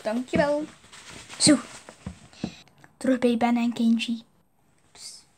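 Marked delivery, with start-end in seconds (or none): 4.9-5.2 soft hiss to get attention; psst whisper. Acted. Sneaking up to Ben and Kenji, whispering psst. Secretly calling someone.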